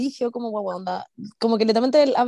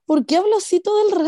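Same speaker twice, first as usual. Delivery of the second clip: exasperated; 7 semitones higher, 5 dB louder than usual. Retold or imitated. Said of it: "es como".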